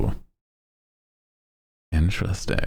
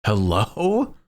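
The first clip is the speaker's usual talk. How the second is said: mock outrage; 4 semitones higher, slower than usual. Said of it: "And I'm like".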